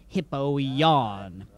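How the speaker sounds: dramatic title read. Acted. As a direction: sink low and slow on the name.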